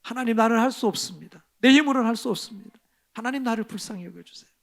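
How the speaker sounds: rising then hushed plea; earnest pleading prayer to God; fervent prayer. Shown as a demonstration. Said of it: "이렇게 기도하시길 바랍니다".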